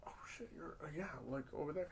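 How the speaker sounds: starstruck mumble. Retold or imitated. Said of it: "I'm like".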